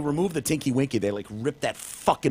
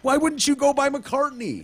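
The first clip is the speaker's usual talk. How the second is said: much higher incredulous question; incredulous squeal; 3 dB louder than usual. Real, not acted. Asking his co-host about the [name] name in high disbelief.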